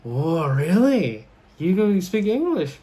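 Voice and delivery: drawn-out, swooping high delight; happily impressed. Retold or imitated. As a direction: imitate a delighted grandmother, stretching the words. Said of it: "happy look on her face".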